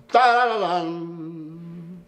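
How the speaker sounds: "tarararán" hit on the first note, then dragged out; held, over-long "tarararán" with a front accent; mistaken accent. Retold or imitated. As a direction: sing out "tarararán" long, weight on the opening note. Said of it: "Usted hace".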